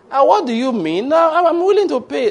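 high, loud, insistent debtor; acted high-pitched protest; astonished protest. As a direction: plead in mock disbelief, pitch soaring. Acts debtor protesting the refusal.

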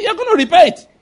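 high, loud retold demand; retold anger. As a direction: imitate an angry friend insisting, pitch jumping up. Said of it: "my friend was very angry".

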